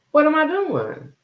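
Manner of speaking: normal talking voice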